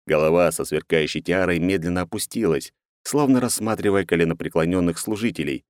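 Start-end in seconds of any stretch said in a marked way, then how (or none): none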